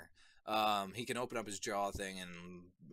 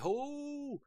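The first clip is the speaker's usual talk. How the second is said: a much higher, startled "Oh!"; crowd's stunned "Oh!". Retold or imitated. Everyone gasping as he turns into the Colossal Titan. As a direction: gasp it like a shocked onlooker. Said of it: "And everybody's like".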